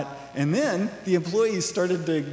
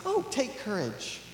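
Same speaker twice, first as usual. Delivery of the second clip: mock soothing; 5 semitones higher, 6 dB quieter than usual. Disciples consoling the beggar.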